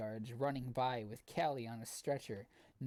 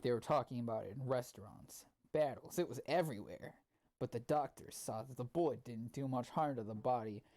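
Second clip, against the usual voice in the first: normal talking voice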